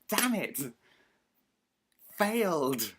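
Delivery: loud, high cry of self-reproach; mock frustrated outburst. Vlogger cursing his own failed memory, mock frustrated outburst. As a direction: burst out in exaggerated defeat. Frustrated at getting the name wrong.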